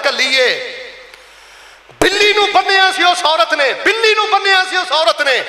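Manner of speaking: high, ringing sermon delivery; impassioned preaching. Acted. Preacher warning the congregation about cruelty, impassioned.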